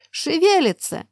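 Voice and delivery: high, loud terrified cry; panicked scream. Acted. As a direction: shriek it in panic. Panicking archer. (испуганный высокий вскрик)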